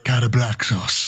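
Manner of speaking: slow, rolled Scottish accent; Peter Capaldi. Shown as a demonstration. Quizmaster showing off an accent on request, Scottish accent. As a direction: put on a drawn-out Scottish accent. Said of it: "Can you do a Capaldi accent".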